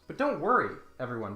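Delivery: ordinary talk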